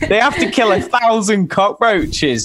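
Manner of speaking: breaking up with laughter while talking; real helpless laughter. Real, not acted. Telling co-hosts how many cockroaches they need to kill, laughing. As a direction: struggle to get the words out through laughter, pitch climbing. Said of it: "I can't even say it without laughing".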